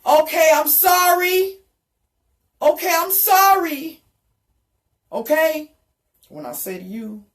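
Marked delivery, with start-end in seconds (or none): 0.1-5.7 high, loud begging apology; frantic apology. Retold or imitated. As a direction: cry out sorry in panic. Pleading apology to God.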